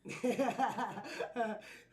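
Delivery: loud belly laugh; hearty laugh. Real, not acted. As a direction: crack up laughing. Laughing at clip.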